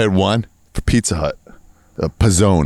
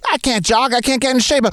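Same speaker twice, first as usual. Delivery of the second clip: much higher, whiny jogger; jogger victim; 13 semitones higher than usual. Retold or imitated. Law and Order opening jogger complaining, high and whiny. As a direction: whine it like a TV jogger. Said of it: "we're like".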